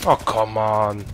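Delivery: loud, drawn-out exasperation; real gamer frustration; exasperated. Real, not acted. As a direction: groan it out loudly, stretching each word. Frustrated gamer after a setback.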